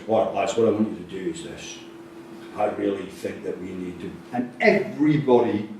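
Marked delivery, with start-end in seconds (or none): 0.1-4.1 a coach going low and calm instead of shouting; quiet calm coach. Retold or imitated. Coach at half time hushing a noisy dressing room, quietly. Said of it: "went down to his level".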